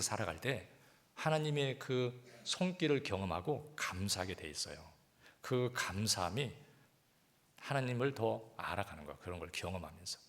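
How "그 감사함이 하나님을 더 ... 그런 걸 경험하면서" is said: ordinary delivery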